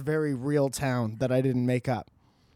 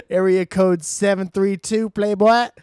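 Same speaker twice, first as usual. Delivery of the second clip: hype shout-out; 4 semitones higher, 8 dB louder than usual. Acted.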